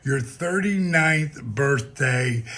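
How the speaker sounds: ordinary talk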